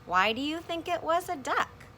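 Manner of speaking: ordinary talk, nothing marked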